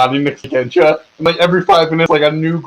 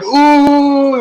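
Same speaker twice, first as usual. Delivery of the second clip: high, drawn-out ooh of awe; impressed ooh; 12 semitones higher, 4 dB louder than usual. Acted. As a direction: coo it up high like gawking onlookers. Drow guys impressed by palanquin.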